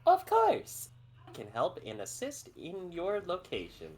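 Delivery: bright, high helper robot; chirpy guide robot voice. Acted. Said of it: "the guide robot goes".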